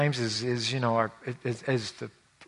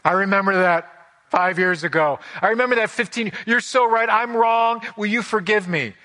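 higher and louder, a hammed-up contrite surrender; sarcastic mock apology; 7 semitones higher, 6 dB louder than usual. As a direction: overplay the sweet giving-in nobody ever says. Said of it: "The other person goes".

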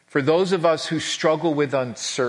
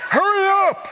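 high, louder retold scream at the group; 4 dB louder than usual. Pastor rushing his missions group through customs, mock scream. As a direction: yell it way up high, hurrying stragglers along. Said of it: "screaming at our group".

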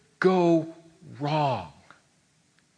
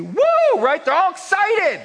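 much higher, louder, bursting with joy; excited shout; 6 dB louder than usual. Acted. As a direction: shout it like the crowd's cheering. Israelites celebrating at the sea.

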